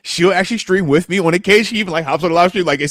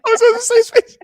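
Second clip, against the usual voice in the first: high, whiny fake-hurt whimper; mock whimper; 4 dB louder than usual. Co-host fake-crying that this was a safe space. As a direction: pretend to be wounded, whining high. Mock whine, cry heard.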